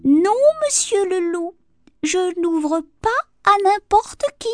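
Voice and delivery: cheeky piglet, high and loud; a high-pitched, teasing little pig voice; joking little pig. A narrator's reading. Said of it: "ne put s'empêcher de plaisanter". (petit cochon effronté qui plaisante, voix aiguë)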